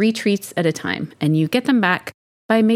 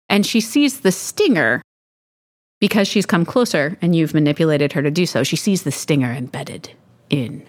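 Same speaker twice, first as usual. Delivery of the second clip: ordinary talk, nothing marked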